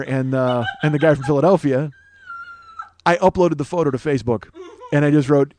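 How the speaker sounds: ordinary delivery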